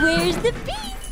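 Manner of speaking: high, rising sing-song voice. Shown as a demonstration. Repeated in high tone.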